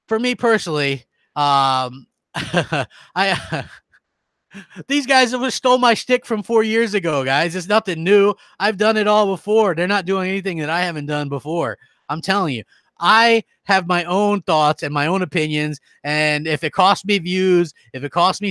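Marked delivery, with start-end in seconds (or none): none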